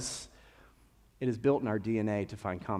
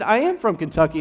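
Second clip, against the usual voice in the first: loud, higher, eager affirmation; excited reply; 5 semitones higher, 9 dB louder than usual. Retold or imitated. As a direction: proudly claim it, hitting "am". Acted delighted reply to fellow Kentuckian.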